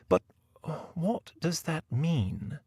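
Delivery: high, soft, slow query; puzzled lady novelist. Acted. Novelist interrupts the men's dispute, high soft puzzled voice. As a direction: wonder aloud softly in a high lady's voice. Novelist asks what name means.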